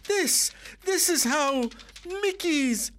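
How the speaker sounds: high, rising outburst, slowed; exclaiming. Acted. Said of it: "she exclaimed, voice escalating with each word".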